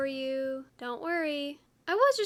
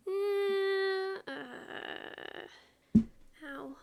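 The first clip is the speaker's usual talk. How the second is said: pained whimper; 6 semitones higher, much slower than usual. Acted. Mock pained whine demolishing.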